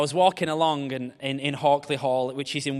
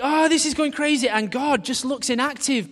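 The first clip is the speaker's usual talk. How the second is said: higher, louder mock fretting; lightly voiced panic; mock worried believer; 12 semitones higher, 5 dB louder than usual. Preacher voicing a fretting believer, mildly mock panicked.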